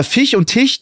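Fisch and Tisch as "Fich" and "Tich"; mispronounced sch. Shown as a demonstration. Podcaster demonstrating a speech defect, mispronounced Fisch and Tisch. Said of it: "tatsächlich ein Sprachfehler".

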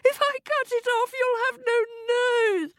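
very high-pitched protest; high, fraught wifely wail. A narrator's reading. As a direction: lament in hammed-up distress. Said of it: "wailed Missus Smith".

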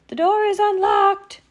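very high, loud falsetto; wolf badly posing as an old lady; overdone granny call through the door; fake Granny voice. A narrator's reading.

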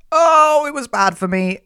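high, loud wail easing down; dramatic swoon. Acted. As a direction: swoon helplessly, hammed up. Swooning over his hot voice.

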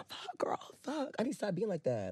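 breathy whisper, scolding herself; whispered self-scolding. Retold or imitated. Telling friend what she says to herself, whispering. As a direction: whisper it like a private regret.

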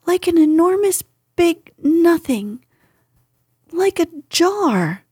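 high, flustered little piglet; stumbling over words in excitement. Acted. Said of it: "said Piglet".

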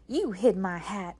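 loud, indignant charge; angry accusation. A narrator's reading. May May blames her sister for the hat, angrily. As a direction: accuse with force.

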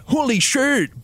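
mock shock. Death Eaters exclaim.

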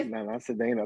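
Cedeno said the teachers' way, se-DAY-no; anglicized mispronunciation. Retold or imitated. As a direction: Cedeno in flat English, mocking the teachers. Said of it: "what the teachers in school used to always call me".

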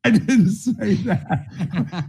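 slow denial through laughter; laughing. Real, not acted. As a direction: laugh it off, stretching the words slowly. Laughing denial after joke.

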